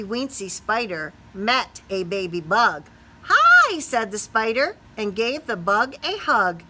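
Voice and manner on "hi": loud, much higher hi; bright eager hello; excited greeting. Acted. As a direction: call out a cheery hi. Spider greets baby bug.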